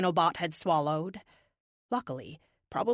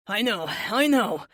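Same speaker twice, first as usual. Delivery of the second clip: put-on slow, louder Boston-accented reply; Bostonian accent; 2 semitones higher, 5 dB louder, slower than usual. A narrator's reading. Tired teammate answers his leader, Bostonian accent. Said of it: "replied in his Bostonian accent".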